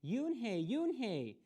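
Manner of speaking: high, drawn-out call up the stairs; calling upstairs. A narrator's reading. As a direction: call her child down from below. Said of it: "Mother called up to Yoonhae".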